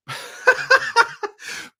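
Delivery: high unvoiced fit of laughter; helpless laughing fit; breathless high laugh. Real, not acted. Breaks down after funny memory.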